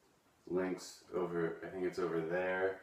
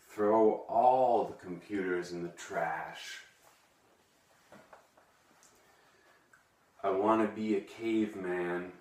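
emotional poem reading; 4 dB louder than usual. Acted. Vlogger reading his own angry poem to viewers, overwrought and dramatic. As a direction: declaim the poem like it tears you apart. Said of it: "I get a little emotional, whenever I read that".

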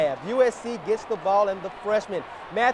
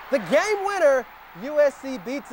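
excited call; 6 semitones higher than usual. Real, not acted. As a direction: pitch jumps up as he calls the winner. Big moment of the highlight call.

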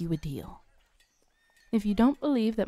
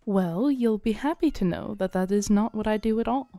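normal talking voice

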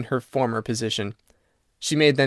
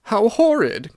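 cried out; 9 semitones higher, slower than usual. A narrator's reading. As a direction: cry it out in disgust. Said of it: "cried Missus Otis".

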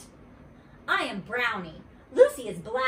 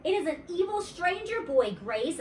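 mild, higher-pitched shout back; 8 semitones higher than usual. A narrator's reading. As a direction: raise the pitch as if calling back to a friend. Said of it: "I shouted back".

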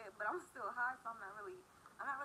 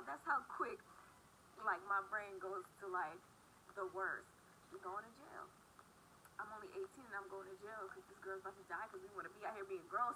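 ordinary delivery

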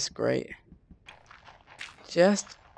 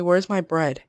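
ordinary talk, nothing marked

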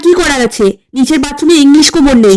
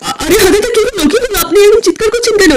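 alarmed, higher and pitched up; startled by the yelling, ask in a panic; 6 semitones higher than usual. Acted. Man hearing the guest scream, asking in alarm. আপনি এরকম চিৎকার করছেন কেন?.

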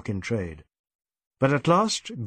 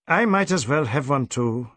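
usual speaking voice

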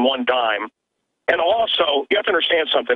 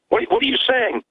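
retold disbelief; 9 semitones higher than usual. Mancow retelling his stunned reply to the actor, surprised. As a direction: pitch jumps high in startled disbelief.

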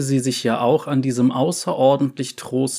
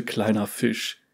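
pleading; 2 semitones lower, 6 dB quieter than usual. A narrator's reading. Uncle pleading with niece.

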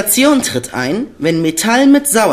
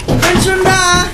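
calling back; 11 semitones higher, slower than usual. Acted. Justus answering his uncle while rushing down, calling. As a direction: shout back while hurrying.

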